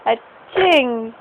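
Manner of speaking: mock sneeze. Mother makes baby smile with sneezing, playful mock sneeze. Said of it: "Ngumiti pa yan na pagka-atching".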